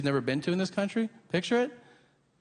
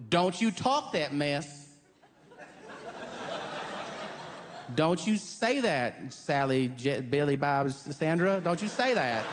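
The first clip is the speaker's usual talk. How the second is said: Southern comforter; 2 semitones higher, slower than usual. Retold or imitated. Friend consoling the lady, slow Southern drawl. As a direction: soothe her like a church auntie from the South. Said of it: "someone will go".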